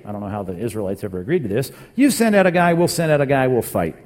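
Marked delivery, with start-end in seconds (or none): 1.9-4.0 raised, higher voice for a challenge; called-out challenge. Acted. Philistines propose a one-on-one fight to Israel, called out loudly. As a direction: call out the terms to the other army.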